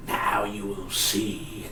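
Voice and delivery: slow, quiet, ominous villain line; imitating Zoom, slow and soft; menacing villain voice. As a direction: drag it out like a supervillain.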